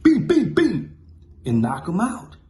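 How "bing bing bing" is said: high, loud bing bing bing; punch sounds. Retold or imitated. Narrator mimics knocking him out, loud punch noises.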